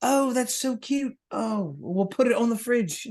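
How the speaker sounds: put-on high-pitched gushing over a kid's effort; mock childish excitement. Bandmates humouring the drummer's song, mock gushing. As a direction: coo it very high like an indulgent parent. Mocking childish songwriter and doting parents.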